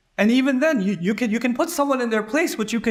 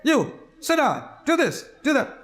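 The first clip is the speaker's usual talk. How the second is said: higher-pitched stern teacher giving orders; strict teacher; 7 semitones higher than usual. Retold or imitated. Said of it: "the teacher says".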